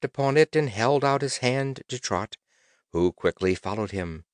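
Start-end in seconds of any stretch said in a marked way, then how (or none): none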